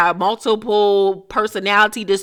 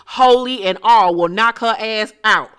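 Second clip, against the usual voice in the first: ordinary talk, nothing marked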